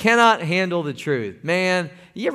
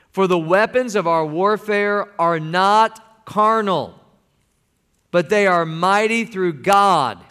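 slow, louder, stretched-out scripture reading; preaching scripture; 4 semitones higher, 4 dB louder, slower than usual. Acted. Pastor reading scripture aloud with the congregation, slow and loud. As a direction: lead the church through each word.

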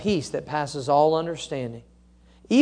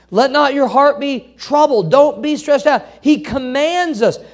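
1 emphatic preaching; 7 semitones higher, 5 dB louder than usual. Real, not acted.

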